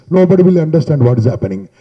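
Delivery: booming, blown-out sound with the mic jammed at the mouth; mic held too close. Shown as a demonstration. To students, showing bad mic handling with a booming mic. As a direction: let the mic overload right against the lips. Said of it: "Mic handling is some people keep like this".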